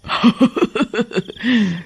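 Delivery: high mocking witch laugh; high, falling evil laugh; witch cackle.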